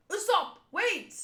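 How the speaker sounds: high acted warning cry; urgent calling out. Teammate warns Usopp mid-fight, acted urgent call. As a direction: call out to stop him, high and sudden.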